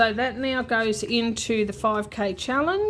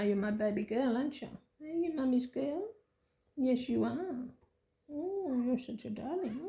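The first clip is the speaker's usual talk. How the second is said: much softer doting pet voice; 2 semitones higher, 10 dB quieter than usual. Real, not acted. Cooing to her cat.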